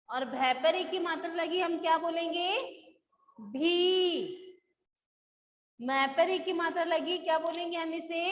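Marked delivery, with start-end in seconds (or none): 3.4-4.4 "भी" with the ee held long; long ee vowel. Shown as a demonstration. Teacher modelling the matra for students, long-drawn "भी". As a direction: stretch out the vowel of "भी". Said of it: "लंबा होता है ना".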